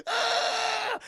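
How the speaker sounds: long, high scream of acted pain; agonized scream. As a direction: shriek as if just shot. Man shot in the eye.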